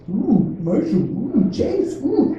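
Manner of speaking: put-on high, swooping little-kid oohs; daughter's surprised oohs. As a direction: act out a small child delighted by each discovery. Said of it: "She's still so surprised every time".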